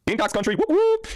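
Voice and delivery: high-pitched team cheer; whooping cheer. Real, not acted. Host shouting out Gamecocks fans, high whooping cheer. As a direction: call it out high, ending in whoops.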